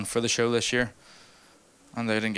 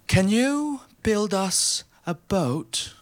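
slow, much higher posh English toff; 10 semitones higher, much slower than usual. Retold or imitated. English visitors asking Belfast shipbuilders, mock posh English. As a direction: drawl it like a pompous English gent. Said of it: "English people ... were like".

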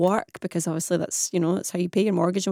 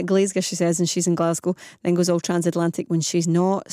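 ordinary talk, nothing marked

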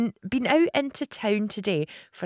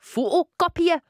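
"Photocopier" said in a Geordie accent, higher and louder; 10 semitones higher, 7 dB louder than usual. Shown as a demonstration. Host tests a Geordie accent on one word. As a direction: hit "Photocopier" Geordie style. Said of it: "I like to use photocopier".

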